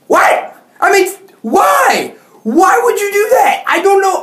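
high shouting disbelief; furious shouted why; outraged shout. Real, not acted. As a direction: yell it out, high and exasperated. Outraged rant at teacher.

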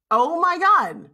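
loud, high, thrilled exclamation; amazed excitement. Retold or imitated. Teen at a national pageant with Bob Hope, amazed. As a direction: exclaim it in delighted disbelief. Reliving reaching a national competition.

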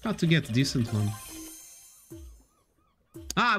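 ordinary talk, nothing marked

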